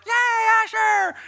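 very high squealed cheer; imitating excited girls cheering; loud, drawn-out mock squeal. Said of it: "the girls are like".

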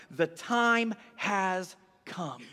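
very slow, weighty pronouncement; solemn declaration. Retold or imitated. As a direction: give each word its own weight. Solemn quote of Jesus' message.

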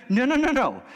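normal talking voice

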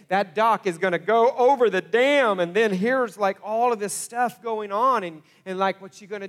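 rising panic. Retold or imitated. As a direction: let the anxiety boil over. Spiralling worries voiced aloud.

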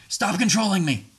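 high-pitched demand to stop; accusing outburst. Acted. Partner accuses the speaker of controlling them, accusing. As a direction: throw it out as a sharp accusation.

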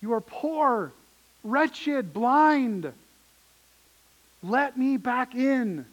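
a voice of God calling to a church that shut him out; Jesus pleading with the church. Acted. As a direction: cry out to them to be let back in. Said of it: "He's saying to the church".

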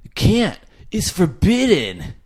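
high-pitched Californian bro impression; hammed-up surfer-kid voice; Malachi. Doing own practiced Malachi accent, high bro voice. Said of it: "like, rocket power".

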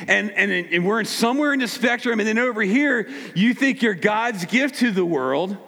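ordinary delivery

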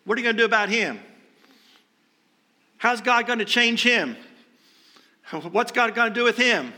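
acted-out nosy disciple's questions; questioning Peter. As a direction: ask as if deflecting onto someone else. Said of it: "Peter turns around and says".

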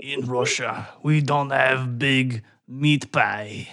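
slow, clumsy fake Russian accent; hammed-up Russian accent, slow; bad Russian accent. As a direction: play a gruff Russian, badly. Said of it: "just a terrible Russian accent".

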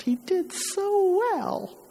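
high, drawn-out maternal pride; soaring, slow and proud; proud exclamation. Acted. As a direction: stretch it out, swelling with pride. Proud mother recalls bar mitzvah.

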